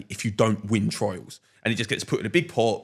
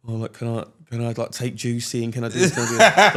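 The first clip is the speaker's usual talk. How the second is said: laughing; 5 dB louder than usual. Retold or imitated. Retelling his own eager questions.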